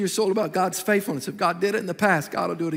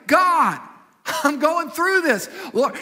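crying out to God; 7 semitones higher, 4 dB louder, much slower than usual. Shown as a demonstration. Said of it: "Cry out to God".

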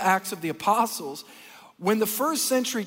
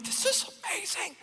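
awed; 11 semitones higher, 7 dB quieter than usual. Retold or imitated. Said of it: "I'm like".

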